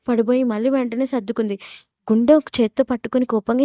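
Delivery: usual speaking voice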